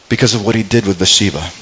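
ordinary talk

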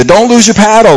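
high, urgent barked order over the rapids; a river guide shouting orders at the rafters; guide barking directions. Retold or imitated. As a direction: imitate the guide yelling a warning. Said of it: "this guy is barking out directions".

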